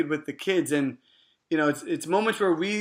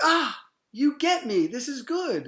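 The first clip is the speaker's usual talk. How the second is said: put-on child's relief; 7 semitones higher, slower than usual. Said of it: "They're like".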